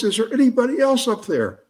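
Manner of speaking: high-pitched, strained-up storyteller's yell; hammed-up desperate call up the well; mock yell. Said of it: "yells at the top of his voice".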